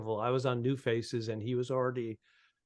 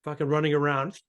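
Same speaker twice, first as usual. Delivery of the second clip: usual speaking voice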